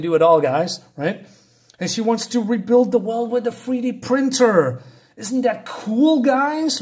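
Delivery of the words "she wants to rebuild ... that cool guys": mock gushing. Mocking gushing about character.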